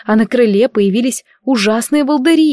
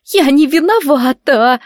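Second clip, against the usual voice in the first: high, slow tearful wail; accused girl sobbing she is not guilty; sobbing protest; 6 semitones higher, slower than usual. Acted. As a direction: wail the denial through tears. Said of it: "снова зарыдала Гизелла".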